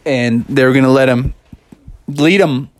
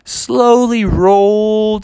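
high, stretched-out vowels; drawn-out slow narration; 8 semitones higher, much slower than usual. A narrator's reading. Storyteller showing Father easing through the lot, slow-motion drawl. Slow rolling acted in sound.

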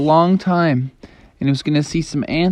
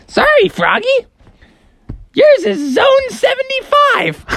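redone mom voice. Shown as a demonstration. Mom teasing Froggy about his boarding zone, very high and smug. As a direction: squeak it out like a smug cartoon mom. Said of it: "I didn't do her voice very good".

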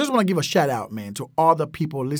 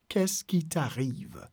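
"Qu'est-ce qui t'arrive" slow and softer, syllable by syllable; slow syllable breakdown; 7 dB quieter, slower than usual. Shown as a demonstration. Teacher modelling French for learners, slow syllable breakdown. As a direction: stretch it out gently for learners.

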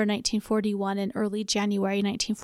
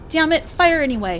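higher, louder frustrated curse; angry shouted order; 6 semitones higher, 6 dB louder than usual. Acted. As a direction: bark the order out in anger. Officer learns guns useless.

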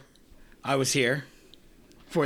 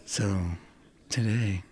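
sultry podcast voice; 7 semitones lower than usual. Pretend. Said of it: "sultry, uh, podcast voice whole time".

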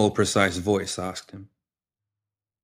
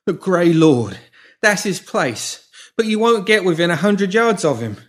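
frantic; 10 semitones higher, 5 dB louder than usual. A narrator's reading. Pimp confessing in fear.

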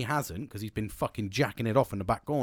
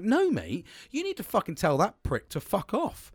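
higher-pitched, exasperated objection; indignant refusal; 8 semitones higher than usual. Retold or imitated. Worker objecting to boss's lockdown, indignant refusal. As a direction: push back, pitched up in protest.

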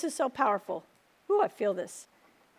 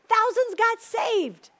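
thousands got saved, higher, louder and slow; proclaiming loudly; 8 semitones higher, 6 dB louder, slower than usual. Real, not acted. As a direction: ring it out like a triumphant proclamation. Preacher proclaiming the climax.

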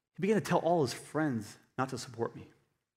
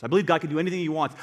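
ordinary talk, nothing marked

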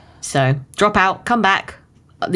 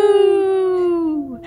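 high drawn-out wolf howl; 13 semitones higher than usual. Shown as a demonstration. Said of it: "Sounds just like this".

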